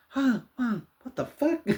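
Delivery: put-on high, startled kid's voice; a scared child blurting it out; freaked-out kid. A kid scared by the movie asks, high startled voice.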